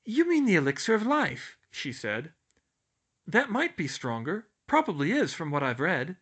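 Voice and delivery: high, quick realization; earnest schoolgirl voice. A narrator's reading. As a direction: piece it together eagerly. Hermione realizing.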